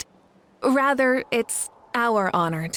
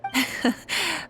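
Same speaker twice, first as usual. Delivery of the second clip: a short rising chuckle; amused laugh; 6 semitones higher, 4 dB quieter than usual. Real, not acted. Amused at the boast, a short rising laugh.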